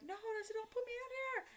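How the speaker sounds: very high-pitched, desperate protest; retold panicked plea. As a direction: re-enact begging in a high squeal. Pleading while forced into trunk.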